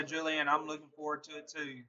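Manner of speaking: normal talking voice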